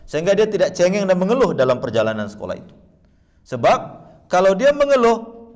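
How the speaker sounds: loud, high, rousing sermon voice; preacher urging parents, loud and fervent; preaching at full force. Acted. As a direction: drive the message home to the congregation. Preaches to the audience.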